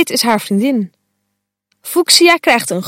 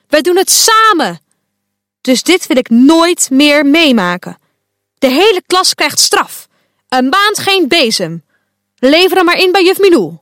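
boze straf; 5 semitones higher than usual. A narrator's reading. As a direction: hand out the punishment angrily. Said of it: "roept Quark". (boos en luid straf uitdelen)

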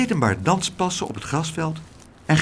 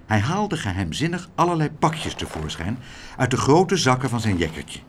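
ordinary delivery, nothing marked

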